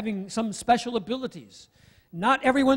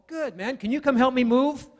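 sly friend ask; 5 semitones higher, 5 dB louder than usual. Retold or imitated. Acted friend springing the request.

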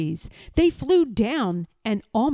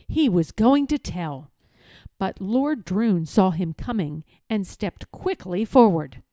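usual speaking voice

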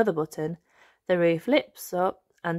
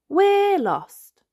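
put-on high, louder little girl announcing; higher child's we're lost; Peppa voice; 13 semitones higher, 6 dB louder than usual. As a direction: tell it like a worried piglet. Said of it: "says Peppa".

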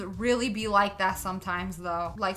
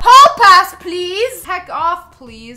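much louder, higher shout; shouted plea; 6 semitones higher, 17 dB louder, much slower than usual. Real, not acted. Player shouts at the hall monitor, loud plea. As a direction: yell the words at him. Waving pass at guard.

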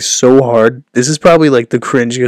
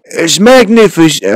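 loud, high, slow failed politician impression; Donald Trump; 6 semitones higher, 5 dB louder than usual. Streamer giving up on a hated voice, bad politician impression. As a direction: stretch it in a poor presidential voice. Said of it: "I just can't do it".

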